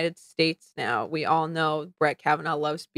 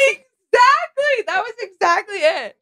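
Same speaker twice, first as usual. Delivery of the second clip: excited; 13 semitones higher, 5 dB louder, slower than usual. Real, not acted. Gleeful agreement with co-host.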